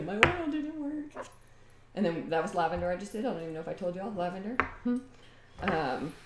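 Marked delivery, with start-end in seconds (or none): 0.0-1.3 high, slow, drawn-out mock dismay; mock upset whine. She retells her frustration at the slow oil bottle, high, slow mock whine. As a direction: whine it like a let-down kid. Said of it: "I was all upset thinking".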